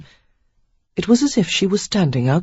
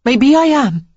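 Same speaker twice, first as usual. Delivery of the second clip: higher, louder defiant toss; Sarah taunting her fiancé, higher and louder; reckless; 9 semitones higher, 6 dB louder than usual. A narrator's reading. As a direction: fling it back, daring him. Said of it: "she said recklessly".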